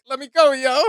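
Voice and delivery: high, loud hammed-up squeal; Slim pleading. Retelling the song, Slim squeals to be let go. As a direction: beg to be let go in a mock panic. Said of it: "and Slim's like".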